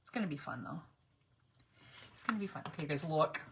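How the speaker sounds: normal talking voice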